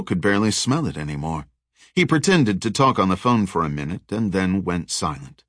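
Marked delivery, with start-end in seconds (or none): none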